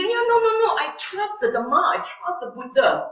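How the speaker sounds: high, eager protest; eager believer voice. Acted. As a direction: insist earnestly like a devout student. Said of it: "Then you say".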